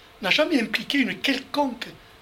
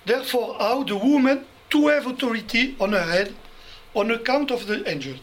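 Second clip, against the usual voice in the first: English read with a strong French accent; 2 semitones higher than usual. Shown as a demonstration. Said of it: "vous allez excuser mon accent". (anglais avec un accent français)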